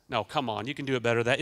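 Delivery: normal talking voice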